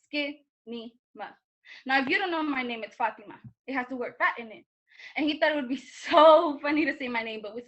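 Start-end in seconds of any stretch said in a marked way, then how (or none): 0.1-1.3 soft, low, drawn-out "Skinny Ma"; slow repeat. Acted. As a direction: break "Skinny Ma" into slow, separate syllables. Repeats the taunt slowly for effect.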